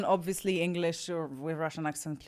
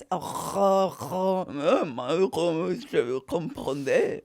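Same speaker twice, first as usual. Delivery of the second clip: throaty mock-French gibberish; mock French smoker; 5 dB louder than usual. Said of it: "this is how they speak because they smoke".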